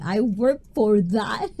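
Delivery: ordinary talk, nothing marked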